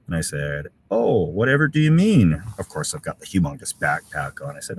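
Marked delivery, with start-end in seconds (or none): 0.9-2.4 higher, mock-innocent question; badly faked innocence, voice lifting; feigned innocence. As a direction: play exaggeratedly clueless when caught out. Retells feigning innocence when caught.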